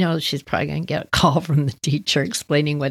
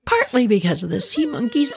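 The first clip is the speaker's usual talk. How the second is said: ordinary talk, nothing marked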